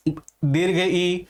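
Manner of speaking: ई stretched out very long and slow; drawn-out long ई; long vowel demo. Shown as a demonstration. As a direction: hold the ई to show it is long. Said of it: "अग्र भाग सक्रिय है".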